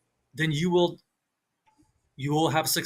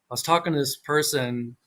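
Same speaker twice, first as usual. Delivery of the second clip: ordinary talk, nothing marked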